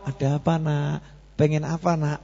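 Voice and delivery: put-on soft, tender asking of a child; gentle parent. Gentle parent asking child what he wants, soft and tender. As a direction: coax the child softly. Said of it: "orang tuanya ngomong".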